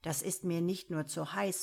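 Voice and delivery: ordinary delivery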